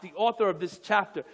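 ordinary talk

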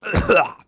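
sudden choking cough; coughing up water. Real, not acted. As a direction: cough on a drink gone wrong. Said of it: "went down the wrong way".

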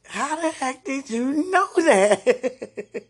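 laughing in amazement, slow and loud; amazed, half laughing; surprised laugh. Real, not acted. Surprised caller asks how he knew.

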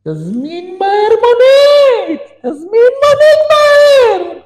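very high, drawn-out screaming demand; sudden outburst. Retold or imitated. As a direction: erupt in an imitated wife's screech. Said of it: "ואז הגברת התחילה פתאום להתפרץ". (התפרצות בצרחות)

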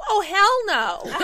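high, drawn-out mock refusal; sassy child imitation. Young kids copying mom, high drawn-out sass. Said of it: "In the same tone".

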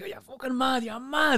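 high, gushing praise from a mate; excited mate. Retold or imitated. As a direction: rave at him like he's a legend. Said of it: "He's very excited".

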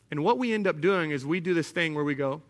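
normal talking voice

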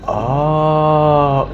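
long drawn-out "ohhh"; imitating someone catching on; knowing "oh". Acting out the guy's knowing reaction.

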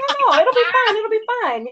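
high, singsong false reassurance; reassuring brain. Retold or imitated. Voicing own brain talking her into it, breezy high reassurance. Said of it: "Your brain's like".

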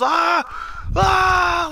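loud, very high mock screams; hella screaming. Retelling the boy fallen on the classroom floor, loud high screaming. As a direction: imitate a boy screaming his head off. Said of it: "Like, just hella screaming".

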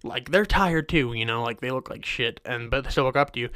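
usual speaking voice

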